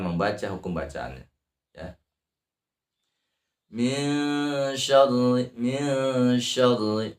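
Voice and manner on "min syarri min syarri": slow chant, long "min" and trilled r in "syarri". Shown as a demonstration. Teacher models the ra, slow chant with trilled "syarri". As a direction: vibrate the tongue tip on "syarri". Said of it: "digetarkan ujung lidahnya".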